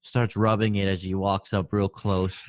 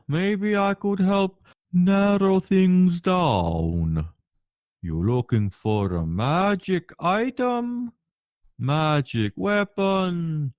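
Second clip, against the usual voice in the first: eager merchant voice; 7 semitones higher, slower than usual. Acted.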